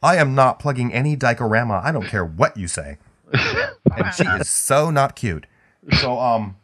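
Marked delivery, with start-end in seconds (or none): 3.3-5.5 put-on valley girl. Answers valley girl bit.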